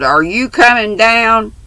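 stern Bluebeard-like call. A narrator's reading. Father summoning the girls downstairs, stern. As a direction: call up the stairs like a stern master. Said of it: "a stern voice from below called like Bluebeard".

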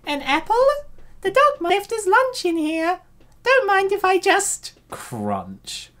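put-on high girlish assistant voice; female assistant voice. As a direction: lift the voice high for the cute assistant. Assistant finds the apple.

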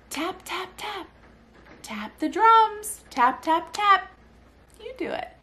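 high, sing-song drumming chant; playful baby talk. Retold or imitated. Mother turns son's tapping into drums, playful sing-song. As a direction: lilt it rhythmically for a little one. Re-enacts game with her son.

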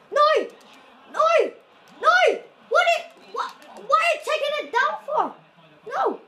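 very high, loud repeated NO yells; furious shouting. Real, not acted. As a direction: scream at the screen in rage. Raging at a referee call.

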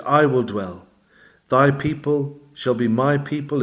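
ordinary delivery

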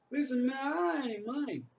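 high, loud "Listen, man, I don't have any money"; nervous protest. Retold or imitated. Chris tells the bigger boy he has no money, high and loud. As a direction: plead your way out of a shakedown. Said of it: "o Chris e fala pra ele".

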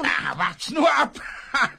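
villain shouts a threat at the captive woman; threatening shout. Acted. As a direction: threaten her, then gloat.

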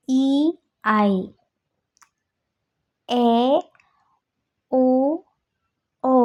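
each vowel drawn out long, one by one; long German vowels. Shown as a demonstration. Teacher slowly sounding out each long vowel for students. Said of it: "they pronounce long".

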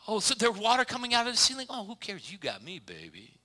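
lightly voiced smug husband; smug doting husband. A husband telling his wife he is all she needs, smug and breezy. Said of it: "husband voice continued".

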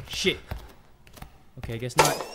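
normal talking voice

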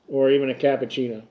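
talking around pipe stem; pipe in mouth. Real, not acted. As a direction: lips gripping pipe. Seen 1: Pipe enters mouth at 3.6s, held there through the rest.

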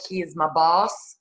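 ordinary delivery

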